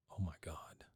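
hushed whisper of dawning shock; whispered realization. Retold or imitated. Wife realizing husband fears propane, whispered. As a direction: breathe it out, stunned. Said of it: "she realizes".

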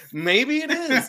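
high laugh through the words. Real, not acted. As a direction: laugh while agreeing. Laughing at laptop joke.

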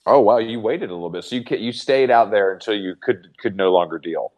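ordinary talk, nothing marked

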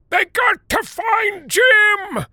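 very slow, high, loud holler; old man hollering from his chair; drawn-out shout. A narrator's reading. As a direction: stretch every word in a shout.

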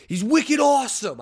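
high, loud mock Boston fan; Boston accent. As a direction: lay the Boston accent on thick. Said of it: "why I was talking like that".

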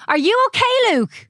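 high, loud call from next room; yelling through doorway. A narrator's reading. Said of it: "He heard Zoe yell through the doorway".

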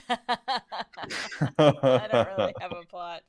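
slow, laughing quote. Retold or imitated. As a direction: repeat it through laughter. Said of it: "quoting with a laugh".

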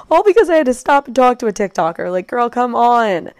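loud, high self-scolding; mock exasperation. Real, not acted. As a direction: scold yourself in comic disbelief. Mock scolds herself for getting lost.

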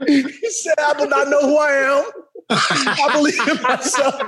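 exasperated plea dissolving into giggles; crying with laughter. Real, not acted. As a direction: struggle to get words out between laughs. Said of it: "I'm crying right now".

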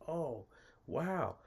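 high, impressed woman's reaction; imitated surprise. Voices her reaction to first time.

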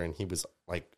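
ordinary talk

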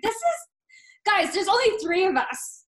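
re-enacted indignant complaint; exasperated protest. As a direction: plead with the family, fed up. Acted protest to her parents.

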